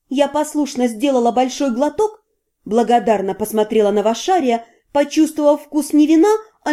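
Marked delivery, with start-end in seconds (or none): none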